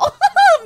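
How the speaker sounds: high, loud cackle of laughter; high giddy laugh; burst of laughter. Real, not acted. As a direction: crack up out loud. Laughs at watching ads.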